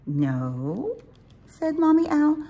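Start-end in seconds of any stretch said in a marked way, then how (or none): 0.1-1.0 questioning mother owl. Acted. Mommy Owl echoes her child's refusal, questioning. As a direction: ask it back, pitch sliding up.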